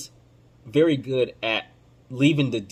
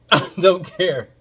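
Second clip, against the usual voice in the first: louder and slower with a laugh; laughing defiance; 5 semitones higher, 4 dB louder, slower than usual. Real, not acted. Host brushing off critics while laughing. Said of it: "I'm emotional".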